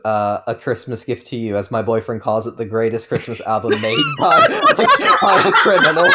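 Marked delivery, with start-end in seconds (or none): 3.8-6.1 laughing through the punchline. Real, not acted. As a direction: break into a laugh mid-sentence. Laughs at own joke.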